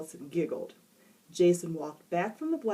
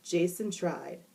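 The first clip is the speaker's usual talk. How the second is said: usual speaking voice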